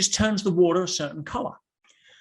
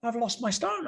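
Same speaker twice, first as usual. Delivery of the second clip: fiancée's upset protest, pitched higher; 6 semitones higher than usual. Retold or imitated. Retelling the fiancée's reply, higher and upset. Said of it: "She says".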